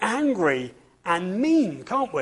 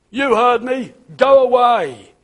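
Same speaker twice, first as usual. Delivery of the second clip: put-on higher, louder cross old grump; grumpy snarl; 5 semitones higher, 7 dB louder than usual. A narrator's reading. Mister Grumpy orders Mister Happy out, snarling. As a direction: shoo him off your garden gruffly. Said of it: "Grumpy by name".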